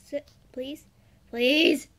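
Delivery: ordinary talk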